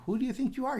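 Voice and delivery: indignant challenge. Retold or imitated. Fighting brother challenges young Moshe, indignant.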